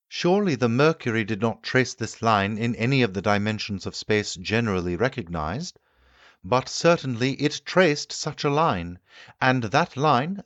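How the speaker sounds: the keen inventor holding forth to his guests; the Time Traveller lecturing. Acted. Time traveller explains the diagram.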